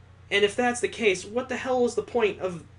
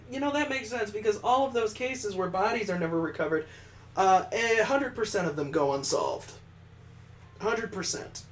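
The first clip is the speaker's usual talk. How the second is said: normal talking voice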